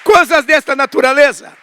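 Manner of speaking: high, loud preacher's exclamation; exclaiming. Real, not acted. Preacher to congregation after a provision testimony, exclaiming.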